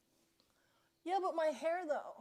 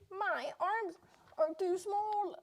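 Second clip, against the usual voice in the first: strained cry; 6 semitones higher, 7 dB louder, slower than usual. Real, not acted. Straining to reach high window.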